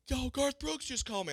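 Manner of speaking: high-pitched thrilled yell, reliving the moment; excited screaming. Retold or imitated. Telling the whole airport [name] called, thrilled high yell. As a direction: shout it out like you can't believe your luck. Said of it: "I'm just screaming to the airport".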